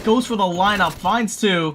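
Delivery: high, loud caster's burst; excited play-by-play. Real, not acted. Caster calling a double kill, excited and loud. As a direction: call the kills with a big lift in pitch and volume.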